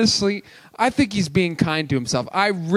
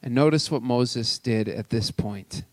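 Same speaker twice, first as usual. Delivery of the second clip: normal talking voice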